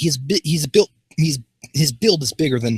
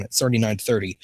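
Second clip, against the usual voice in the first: usual speaking voice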